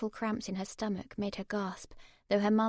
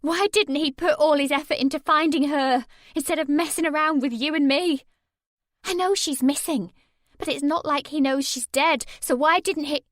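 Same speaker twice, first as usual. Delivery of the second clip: angry outburst, higher and louder; exploding; 7 semitones higher, 11 dB louder than usual. A narrator's reading. Narrator to Mark, exploding in anger. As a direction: burst out in fury. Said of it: "I exploded".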